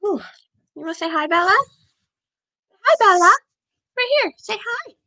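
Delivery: high-pitched coaxing dog talk; talking to pet. Real, not acted. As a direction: coax the dog in a high little voice.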